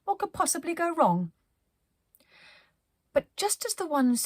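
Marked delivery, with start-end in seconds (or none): none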